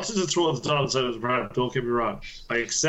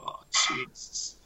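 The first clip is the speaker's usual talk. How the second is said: whispered, drawn-out "oh no" reaction; hushed dismay; 6 dB quieter than usual. Retold or imitated. Engineer seeing his complaint escalated, hushed dismay. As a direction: whisper it slowly, under the breath.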